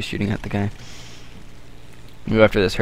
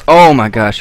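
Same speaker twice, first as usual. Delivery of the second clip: startled; 8 dB louder, slower than usual. Real, not acted. Nearly rammed by enemy plane.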